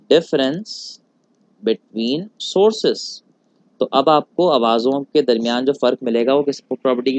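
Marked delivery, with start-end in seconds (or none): 0.1-3.0 very slow, word by word, for note-taking; slow and clear dictation of an English term. Shown as a demonstration. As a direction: spell the definition out for students writing it down. Teacher dictating definition slowly.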